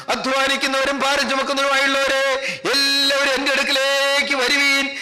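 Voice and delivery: ringing call with long-held vowels; calling out publicly. Acted. Preacher as the Lord publicly calling the burdened to come, calling out. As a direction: summon the whole crowd in sustained tones. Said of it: "കർത്താവ് പരസ്യമായി വിളിച്ചു പറഞ്ഞു".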